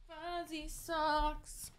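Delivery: slow, soft cooing. Real, not acted. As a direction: coo lovingly over fuzzy socks. Cooing over socks.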